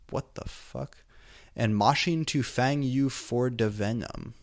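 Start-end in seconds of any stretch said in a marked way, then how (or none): 0.1-1.0 softer, breathy what the fuck under the breath; whispered disbelief. Real, not acted. Reader whispering real disbelief at the absurd text.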